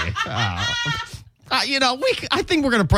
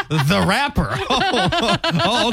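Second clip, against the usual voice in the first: laughing; 9 semitones higher, much slower than usual. Real, not acted. Laughing at [name] joke.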